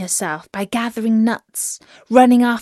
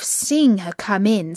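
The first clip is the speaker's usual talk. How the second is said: ordinary delivery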